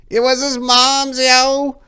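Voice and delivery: high, loud squeal; squealing Four-Way. A narrator's reading. Tied-up young thug squealing. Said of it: "squealed Four-Way".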